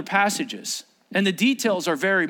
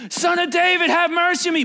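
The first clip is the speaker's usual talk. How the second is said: high, loud, insistent plea; crying out; 12 semitones higher, 5 dB louder than usual. Acted. Blind man keeps on as Jesus walks past, crying out. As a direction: shout it high over the crowd. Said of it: "he cried out all the more".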